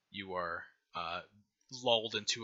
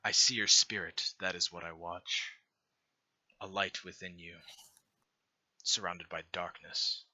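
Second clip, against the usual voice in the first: low, soft, unhurried divine voice; a gentle deity offering a choice, calm and low; benevolent god; 4 semitones lower, 4 dB quieter than usual. Acted. God offering the dying hero a choice, low and soft.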